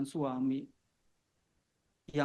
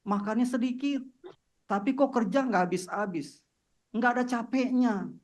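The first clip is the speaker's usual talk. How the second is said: pitched higher and a bit louder, full of wonder; amazed, marveling; 7 semitones higher, 4 dB louder than usual. Real, not acted. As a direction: marvel out loud at how she never tires. Amazed at wife's tireless energy. (heran dan kagum, suara meninggi)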